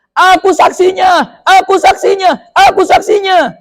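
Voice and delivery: high, loud, slow cry from afar; calling out. A narrator's reading. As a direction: announce yourself over the crowd. Man calls out across crowd.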